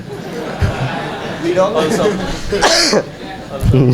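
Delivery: drawn-out laugh at own joke; long chuckle. Real, not acted. Speaker laughing with audience at online-family joke, long chuckle. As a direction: let a long laugh run on.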